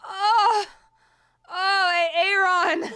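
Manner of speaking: high, loud cry of being moved; real tearful outburst at a sad song; choked-up cry. Real, not acted. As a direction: cry out as the song hits you.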